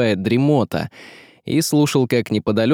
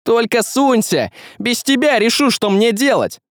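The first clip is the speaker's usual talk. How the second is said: high, angry warning cry; shouted threat; 11 semitones higher than usual. Acted. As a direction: shout a warning after a fleeing enemy. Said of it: "прокричал ей Василий".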